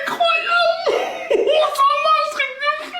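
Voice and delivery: amazed delight. Acted. Son thrilled the remote froze his dad. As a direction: squeal with disbelief that it worked.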